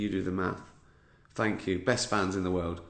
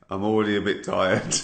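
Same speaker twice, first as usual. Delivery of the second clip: ordinary talk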